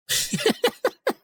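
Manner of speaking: high laugh. Real, not acted. Laugh heard.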